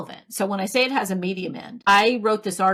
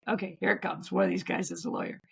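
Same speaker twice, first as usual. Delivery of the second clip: quiet, fast muttered dread; mock-dreading hushed aside; 6 dB quieter, faster than usual. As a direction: mutter to yourself bracing for bad news. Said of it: "And I'm like".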